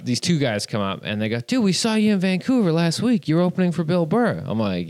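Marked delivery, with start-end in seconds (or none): 1.4-4.4 higher, eager fan voice; excited fans. Retold or imitated. Two guys recognizing the doorman from Vancouver, excited. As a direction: imitate an impressed fan, pitched up. Acting out two fans' greeting.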